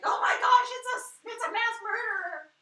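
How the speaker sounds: high, loud hammed-up alarm; mock panic. Teenagers in the woods spotting a killer, mock panic. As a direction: shriek like a silly panicked teenager.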